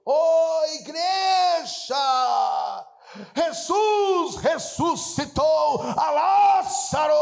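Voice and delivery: high, slow, loud preacher's cry; radio preacher shouting. Retold or imitated. Pastor on the radio crying out to the church, loud and slow. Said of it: "esse pastor pregava dizendo".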